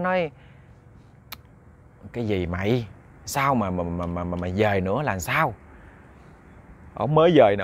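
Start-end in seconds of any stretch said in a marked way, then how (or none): 2.1-5.5 low, soft, puzzled stammer; a hint of a drowsy elder, pitched down; lightly voiced elder cousin. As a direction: question the scared younger man under your breath. Older cousin woken, questions frightened man.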